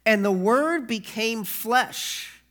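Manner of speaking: slow, loud, weighty proclamation of each line; solemn scripture reading. Acted. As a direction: pastor proclaiming Jesus' words to the congregation, slow and loud. Reads scripture.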